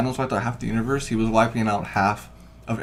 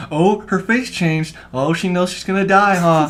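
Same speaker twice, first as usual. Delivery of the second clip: high, louder running commentary; mock-fascinated, drawn out and high; imitated chatty girl; 9 semitones higher, 7 dB louder, slower than usual. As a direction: mimic a girl narrating the film aloud. Mimics girl narrating the movie.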